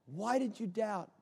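hushed, tender question; soft voice of Jesus to his disciples; gentle reproach. Acted. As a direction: ask it softly after a pause.

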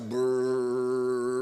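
low, rolled lips engine rumble; engine brrr. Retold or imitated. Preacher imitating a go-kart motor, lip trill. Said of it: "it's like".

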